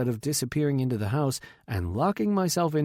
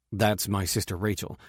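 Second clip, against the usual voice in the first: ordinary delivery, nothing marked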